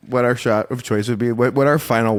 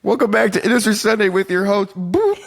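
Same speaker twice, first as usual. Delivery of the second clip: show host intro; 10 semitones higher than usual. Acted. Mock podcast intro.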